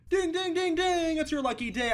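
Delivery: high, bright game-show ding; game show excitement. Acted. Player offering a hug to the employee like a game-show host, high and excited.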